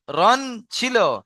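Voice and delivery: রন ছিল said high and slow; slow emphasis. Shown as a demonstration. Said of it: "কিন্তু র হবে কেন?".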